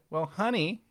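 loud, high sassy "honey" punchline. Real, not acted. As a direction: camp retort, loud and high.

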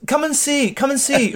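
very high mock-posh summons; excited posh host. Posh host summoning guests to see, mock excited.

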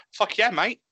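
high-pitched bad New Zealand accent; dodgy New Zealand accent. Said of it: "dodgy fucking accent".

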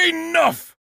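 sudden loud, high cry of Enough; a commanding shout; angry shout. Acted. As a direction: bark it to stop the crowd cold. Friends arrive to rescue.

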